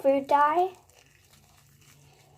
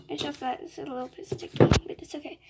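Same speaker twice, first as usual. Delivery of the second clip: much softer, near-whispered aside; softly murmured ASMR apology, a bit fast; 7 dB quieter than usual. Shown as a demonstration. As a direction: keep it hushed for the ASMR. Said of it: "I'm just gonna do some A S M R".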